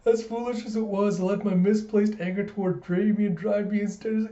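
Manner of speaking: high, whiny theatrical self-pity; hammed-up tragic confession, breaking into laughter; mock glooming. George laments his lost studies, mock glooming. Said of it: "he starts glooming".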